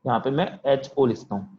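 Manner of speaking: ordinary delivery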